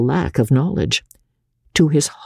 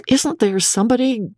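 high-pitched anxious question; young doctor at a loss, pitched way up; helpless appeal; 11 semitones higher than usual. Acted. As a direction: cast about desperately for help.

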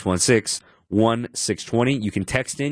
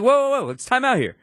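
mock alarm; 12 semitones higher than usual.

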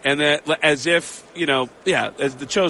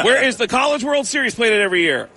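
higher-pitched quizmaster; animated, raised-pitch quiz question; game show host; 7 semitones higher, 4 dB louder than usual. Retold or imitated. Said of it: "we're gonna do a speed round".

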